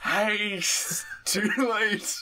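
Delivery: high, strained dying-man voice; dying stepson. Acted. Dying stepson warns his parent, strained character voice.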